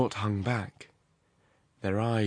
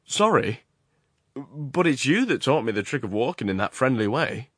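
lightly acted apology, higher and louder; Horrocks; 4 semitones higher, 5 dB louder than usual. Horrocks excuses his grip on his companion's arm, lightly acted. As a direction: excuse yourself with lifted pitch.

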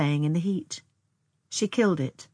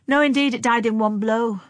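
high, loud, offhand boast; flippant; 6 semitones higher, 7 dB louder than usual. A narrator's reading. Older sister cutting in about the mole, flippant. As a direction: toss it off flippantly, pitched up. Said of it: "with a flippancy that was hateful".

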